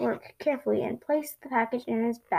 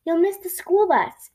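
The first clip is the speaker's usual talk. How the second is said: raised, higher urgent warning; mother hurrying child; 5 semitones higher, 5 dB louder than usual. A narrator's reading. Mother warns Franklin about the bus, urgent call. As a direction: warn a dawdling child, pitched up. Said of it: "said his mother".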